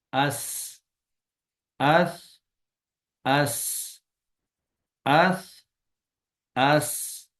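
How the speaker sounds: اس ending in a stopped sound; اس read with a silent final letter; sakin letter. Shown as a demonstration. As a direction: cut اس off sharply on the consonant. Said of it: "اگر ہم ساکن کر کے پڑھیں".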